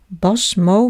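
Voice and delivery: usual speaking voice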